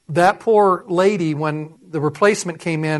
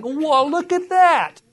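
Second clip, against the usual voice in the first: slow, rising, high-pitched wonder; retold amazement; 10 semitones higher, much slower than usual. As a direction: marvel at a discovery, sliding way up. Retelling his amazed discovery.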